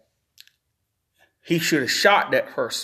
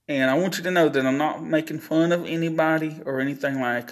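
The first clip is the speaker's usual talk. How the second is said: ordinary talk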